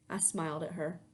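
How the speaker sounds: plain reading voice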